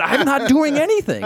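high-pitched, incredulous self-defense; comic squeal of injured innocence; indignant protest. Retold or imitated. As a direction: protest your innocence with voice shooting up. Protesting to his friend.

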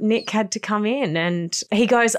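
ordinary talk, nothing marked

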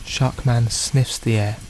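ordinary talk

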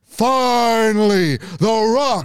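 high, very slow wrestler promo; The Rock. Acted. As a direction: stretch every word like an arena entrance. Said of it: "doing an impression of The Rock".

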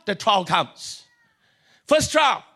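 usual speaking voice

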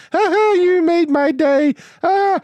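shrill high mock imitation; sneering high-pitched parody. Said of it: "be like".

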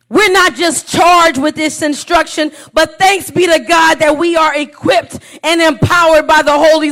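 full-force pulpit preaching. Acted. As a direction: drive the point home to the congregation.

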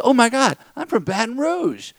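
imitating a delighted fellow Cajun; excited woman. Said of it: "She goes".

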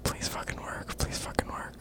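desperate whispered plea; whispering. Real, not acted. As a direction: whisper it, praying it works. Waiting nervously for scammer to answer.

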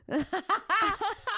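sudden high squealing laugh; high burst of laughter. Real, not acted. A real high laugh bursting out at her own joke.

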